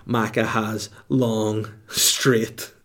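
ordinary talk

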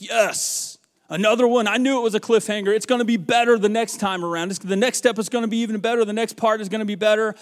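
thrilled fan, high and loud; eager excitement. Acted. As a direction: cheer on the coming sequel. Acting out eager movie audience reaction.

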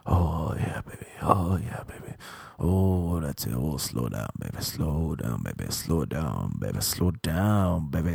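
deep low seductive murmur. Retold or imitated.

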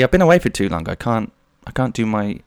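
ordinary delivery

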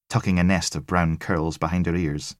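ordinary delivery, nothing marked

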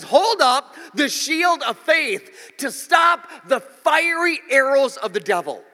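slow, loud proclamation; preaching the verse. Acted. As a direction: thunder out the scripture slowly.

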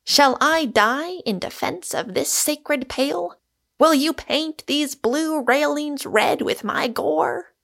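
high, mock-heroic defiance; swashbuckling theatrical challenge; hilarious fierceness. A narrator's reading. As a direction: declaim with gleeful ferocity, pitch well up. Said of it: "with a cry of hilarious fierceness".

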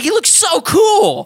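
high, loud, drawn-out fanboy gushing; excited outburst. Retold or imitated. Retelling his trailer reaction to co-hosts, high excited shout. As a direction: gush it slowly in a high shout.

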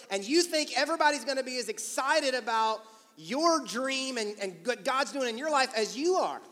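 pumped and building up someone's dream; high on life. Acted. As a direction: pour out giddy enthusiasm. Acting out being high on life.

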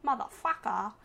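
emphatic actor impression, dropping at the finish; Christopher Walken. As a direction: imitate the distinctive delivery, punched with a falling end. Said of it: "'Cause you know how he talks. It's so specific".

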